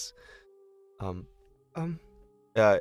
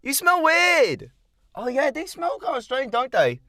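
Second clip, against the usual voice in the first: put-on high, loud, sneering kid voice; snotty child; 7 dB louder than usual. Rude foster kid telling Fen he smells, high and sneering. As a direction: turn up your nose at the stranger.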